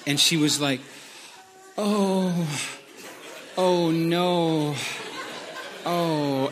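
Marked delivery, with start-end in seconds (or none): 1.8-6.5 drawn-out, mortified groaning "oh"; mock embarrassment. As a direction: imitate someone mortified, dragging each word out. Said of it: "totally red-faced and embarrassed".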